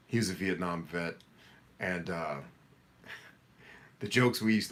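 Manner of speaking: ordinary talk, nothing marked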